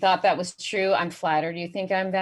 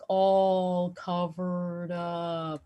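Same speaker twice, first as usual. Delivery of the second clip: drawn-out slow-motion impression of a boss; mock slow-mo speech; 4 dB quieter, much slower than usual. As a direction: stretch every word way out. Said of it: "he would get really slow motion".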